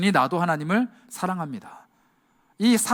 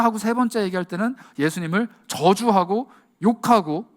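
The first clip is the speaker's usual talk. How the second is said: ordinary talk, nothing marked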